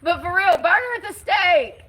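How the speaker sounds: high, loud mock outrage; mock outrage shout. Real, not acted. Ranting to viewers about the protester, shouting. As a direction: shout it like a furious mob. Exaggerated call to burn.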